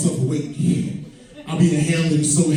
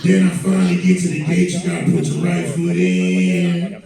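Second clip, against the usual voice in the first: Lavelle Crawford voice; 3 semitones lower than usual. Retold or imitated. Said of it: "this how the conversation went down".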